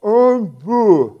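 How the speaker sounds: proud answer mumbled through a mouthful of sandwich, loud and slow; proud, mouth full. Retold or imitated. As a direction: say it proudly with your mouth stuffed. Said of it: "proudly says".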